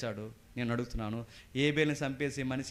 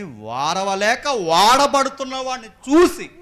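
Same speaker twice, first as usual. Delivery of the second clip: drawn-out words climbing high and loud; slow emphatic build; 9 semitones higher, 14 dB louder, slower than usual. Acted. Preacher explaining Cain's envy, slow and building. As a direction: stretch it out, rising to a cry.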